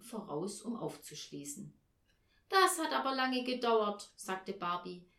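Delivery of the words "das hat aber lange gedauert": lightly voiced child, higher and louder; mild child's complaint. A narrator's reading. Barbie to her mother home from the hairdresser, mild complaint.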